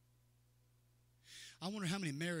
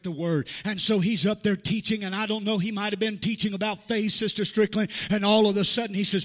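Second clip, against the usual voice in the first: louder, climbing pulpit delivery; full-force preaching; 3 semitones higher, 13 dB louder than usual. Acted. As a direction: drive it home to the congregation.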